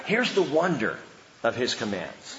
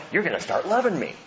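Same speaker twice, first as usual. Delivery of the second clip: ordinary talk, nothing marked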